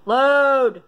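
loud command at a slow page; impatient yell. Real, not acted. Player yells at stalled quiz to load, impatient. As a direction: order it to load, loudly.

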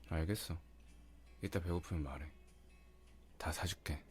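ordinary delivery

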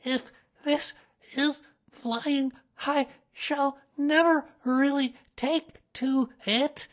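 high, slow, broken-up small animal voice; jolted along, word by word; jerky. A narrator's reading. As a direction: chop it into jolted bits, up high. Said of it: "he thought jerkily to himself".

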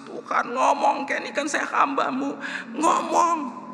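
high-pitched wailing cry to God; retold tearful pleading. Preacher re-enacting his prayer in the car, tearful wail. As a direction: imitate crying out in desperate prayer. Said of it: "lalu saya nangis".

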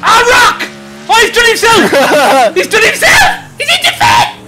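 yelling at a crash in the game; excited shout. Real, not acted. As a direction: shout it out, thrilled and shocked.

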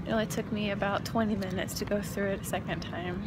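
ordinary talk, nothing marked

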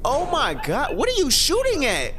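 shocked outburst. Real, not acted. Shocked by realistic creepy clip.

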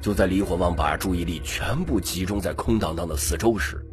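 plain reading voice

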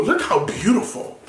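ordinary delivery

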